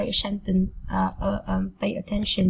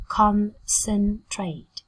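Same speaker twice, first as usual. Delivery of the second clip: "concentrate" slowly in three beats, CON, CEN, TRATE; slow, careful teaching breakdown of "concentrate"; syllable by syllable; much slower than usual. Shown as a demonstration. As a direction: pause between each syllable of "concentrate".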